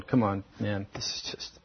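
low, very quiet imitation of tearful begging; understated retold crying; tearful plea. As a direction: plead weakly, voice sinking almost to nothing. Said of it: "He's crying. He's going".

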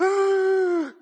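sharp high alarmed gasp; horrified gasp. Retold or imitated. Grandpa gasps at grandson in broken shards, alarmed. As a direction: gasp in sudden alarm at the danger. Grandpa sees bowl shattering.

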